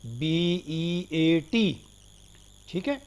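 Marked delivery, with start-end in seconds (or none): 0.1-1.8 slow letter-by-letter spelling; spelled out. Shown as a demonstration. Teacher giving students an example word, spelled out slowly. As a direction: draw out each letter, बी, ई, ए, टी, one at a time. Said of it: "जैसे बीट के अंदर".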